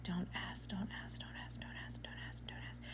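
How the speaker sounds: very soft, repeated whisper; whispered self-warning. Retold or imitated. Customer warning herself before questioning the cashier, whispering. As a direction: whisper it to yourself like a chant.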